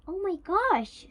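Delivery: high, loud burst of delight; thrilled, high-pitched exclamation; delighted squeal. A narrator's reading. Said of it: "squealed with delight".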